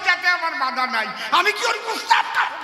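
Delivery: high, laughing indignant outburst; outraged refusal. Acted. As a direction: protest the cure in mock horror. Villager refuses the odd cure.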